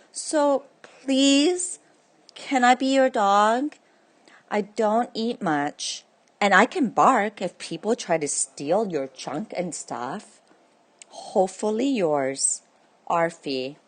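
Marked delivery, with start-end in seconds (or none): 0.1-7.4 pleading puppy. Acted. Dog pleading for a home.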